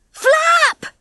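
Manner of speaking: very high, urgent cry; urging. A narrator's reading. Ginger urges Bunty in flight, urgent cry. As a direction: cheer on a flyer desperately. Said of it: "urged Ginger".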